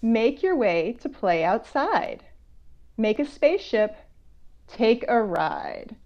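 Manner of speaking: slow, curious read-aloud; reading a picture book. Acted. Author reading to children, slow and curious. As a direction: draw the kids into the mystery.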